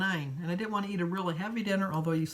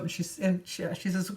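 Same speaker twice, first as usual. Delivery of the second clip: ordinary talk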